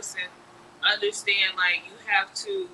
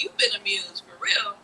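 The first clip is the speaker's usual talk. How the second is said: ordinary talk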